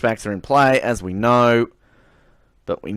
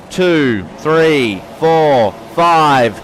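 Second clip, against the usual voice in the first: louder and higher with each number; excited countdown; 5 semitones higher, 6 dB louder, much slower than usual. Real, not acted. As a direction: hype the race start. Race start countdown.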